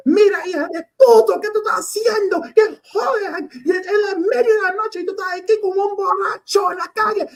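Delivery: high, loud, fast furious Spanish tirade; yelling in Spanish. Retold or imitated. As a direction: tear into him in Spanish, full volume. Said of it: "I start yelling at him in Spanish".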